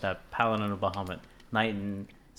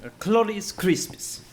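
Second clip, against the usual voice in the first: put-on Italian accent; 9 semitones higher, 6 dB louder than usual. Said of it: "but in Italian accent".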